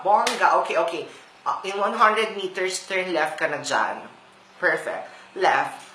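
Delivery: ordinary talk, nothing marked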